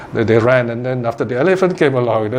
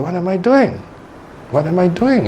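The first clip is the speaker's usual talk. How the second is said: higher-pitched, puzzled self-questioning; acted self-questioning; 7 semitones higher than usual. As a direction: ask yourself, pulled up short. Acted self-questioning on hearing the Dharma.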